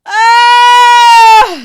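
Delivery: loud high cry of joy; joyful shout. Real, not acted. Reader cheering Dobby's freedom, real shout. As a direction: scream out in delight. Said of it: "Look at God".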